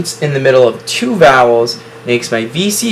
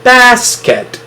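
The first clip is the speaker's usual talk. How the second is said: "Basket" sung out high and bright as the finished word; reading the blended word; 9 semitones higher, 3 dB louder than usual. Shown as a demonstration. Teacher blending syllables for students, high proud read. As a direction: land the whole word "Basket" proudly, higher. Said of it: "Now I can read the word together".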